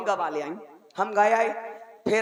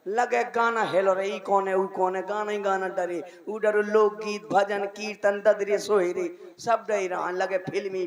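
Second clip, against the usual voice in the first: ordinary talk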